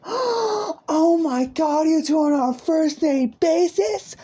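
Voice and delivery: very high, loud squeal; gasp, then shriek it with delighted disbelief; hammed-up gushing, drawn out and piercingly high; thrilled shock. Friend gasps at first-name news.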